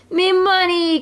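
high, mock-anguished wail; hammed-up high lament; anguished cry. A narrator's reading. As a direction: cry out for a lost treasure. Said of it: "cried Scrooge".

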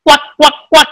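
short, evenly repeated quacks; duck quack. Shown as a demonstration. Teacher shows pupils how a duck quacks, quacking. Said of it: "like a duck".

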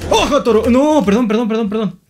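loud, high yelp turning to begging; panicked apology. Pretend. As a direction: cry out, then plead repeatedly in playful panic. Bull attacks, he begs pardon. (grito agudo y súplica asustada)

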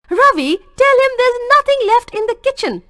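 high, loud call from the back; a fed-up mother calling to her son. A narrator's reading. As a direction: call out across the house. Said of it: "called Rukku Manni".

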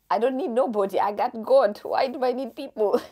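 loud, proud declaration; defiant. Retold or imitated. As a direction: shout it out, full of bravado. Defiant quote to doctors.

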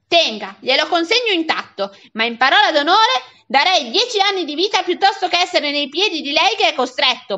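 acted fed-up guardian; exasperated outburst. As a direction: hand the boy over in utter exasperation. Fed-up man hands boy over.

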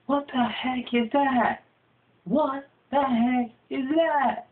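high, slow, drawn-out disbelief; shocked bro. Acted. Bro gawking at the huge mosquito, high and slow. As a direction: stretch it out, stunned at the bug. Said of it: "and he's like".

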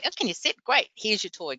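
high, sing-out coaxing, easing down; cheerful puppy talk. Acted. Trainer to an excited puppy offering a toy, cheerful puppy talk.

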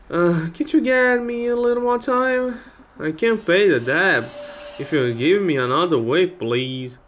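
put-on high-pitched old lady begging for time; old woman pleading with a debt collector. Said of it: "it's an old lady".

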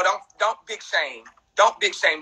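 usual speaking voice

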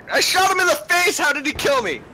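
high, fast outraged yell; furious disbelief at the death; raging gamer. Real, not acted. Outraged after dying.

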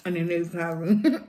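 talking while chewing noodles; covering mouth while chewing; mouth full. Real, not acted. Seen 0: Slurped noodles at -2, jaw working, hand covers mouth at 1.1.

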